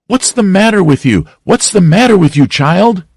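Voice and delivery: high, repeated frightened question. Acted. Aunt Polly at the bedside demanding in fright what is wrong. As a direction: press him in alarm.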